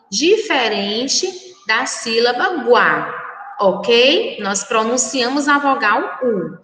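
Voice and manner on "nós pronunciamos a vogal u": usual speaking voice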